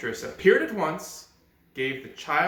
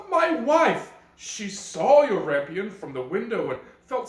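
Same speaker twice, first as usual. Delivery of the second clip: frightened pleading; 7 semitones higher, 4 dB louder than usual. Acted. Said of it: "he was terribly afraid".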